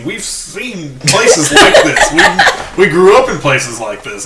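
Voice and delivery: slow, loud words through a laugh; amused, drawn-out laughing delivery. Real, not acted. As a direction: laugh your way through the memory. Reminiscing, laughing with friends.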